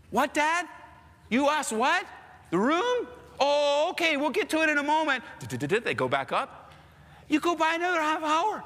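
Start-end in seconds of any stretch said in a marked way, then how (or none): 0.1-5.2 higher innocent voice, last bit rushed; playing dumb kid. Kid stalling his dad about the room, higher and innocent. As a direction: brush dad off with fake innocence.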